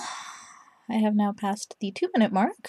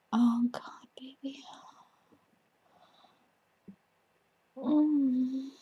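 breathy, much softer whisper; sexy whisper; 3 semitones higher, 7 dB quieter than usual. Pretend. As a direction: murmur it like an intimate tease. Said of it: "sexy talk is a little bit more soft-voiced".

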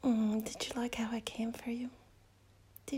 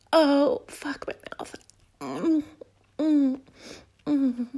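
loud moan; 3 semitones higher, 11 dB louder, slower than usual. Acted. Loud moans.

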